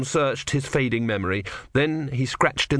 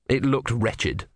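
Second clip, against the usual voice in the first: ordinary delivery, nothing marked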